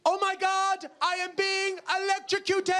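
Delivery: high, shrill-edged mock terror; mock panic. Newcomer stung by nettles, thinking he's electrocuted, mock panic. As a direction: squeal it like you think you're dying.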